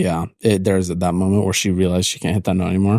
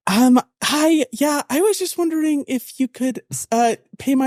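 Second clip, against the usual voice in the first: very high, sugary phone voice; overly sweet call-center caller; customer service voice. Pretend. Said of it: "I love my customer service voice".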